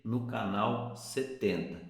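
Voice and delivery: normal talking voice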